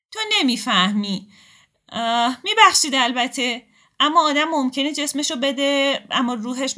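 high, loud wounded fury; fierce retort. Acted. Akhtar lashes out at Nasrin, high and loud. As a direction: spit it back like a cornered cat. Akhtar fights back after crying.

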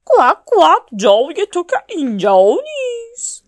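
loud, high, disapproving duck; put-on duck voice, high and loud; another duck. A narrator's reading. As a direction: quack it out to shoo off an intruder. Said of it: "یه اردک دیگه گفت".

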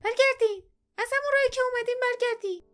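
very high, loud, fast order; old woman's shout. Acted. Old woman ordering strangers to turn back, high quick shout. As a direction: shout them away in a high voice. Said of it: "فریاد زد".

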